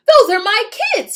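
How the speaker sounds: loud, high scratchy witch voice. Acted. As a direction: rasp it out in shock. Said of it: "in a scratchy voice".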